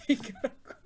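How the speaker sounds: laughed through, breathy giggle; can't stop laughing while saying it. Real, not acted. Laughing retelling of gossip.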